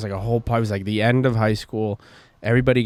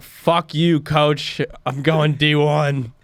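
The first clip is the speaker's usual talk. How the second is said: put-on drunken defiance, laughing; 5 semitones higher, much slower than usual. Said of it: "And I'm throwing up as I'm saying this".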